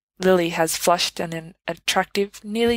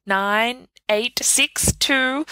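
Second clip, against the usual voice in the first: higher voiced countdown, skipping numbers; magic mistletoe hurrying the pair, higher voice; mistletoe counting down; 6 semitones higher, much slower than usual. A narrator's reading. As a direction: rush them along like a pushy charm.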